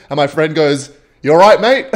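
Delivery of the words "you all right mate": louder, higher call down the corridor. Retold or imitated. Friend calls to the glaring neighbor, louder and higher. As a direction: mock-friendly shout of you all right mate.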